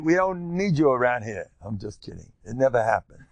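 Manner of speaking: ordinary delivery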